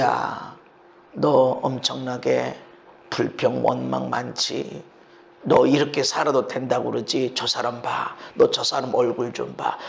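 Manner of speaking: a hint of a stern inner voice; lightly voiced rebuke. God rebuking the listener. (낮고 느리게 꾸짖는 목소리)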